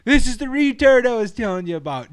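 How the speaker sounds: loud, high-pitched holler; imitated yell. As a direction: yell it out across a job site. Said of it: "Just yells it out across".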